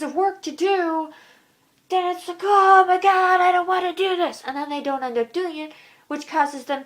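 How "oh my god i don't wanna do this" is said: high, loud imitation of a reluctant kid; mock whining. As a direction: act out a kid's dismayed complaint. Voices a kid dreading homework.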